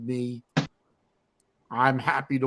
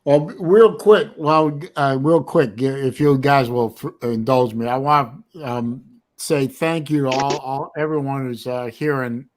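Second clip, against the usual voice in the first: host announcement; 6 dB louder than usual. Real, not acted. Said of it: "host addresses the stream".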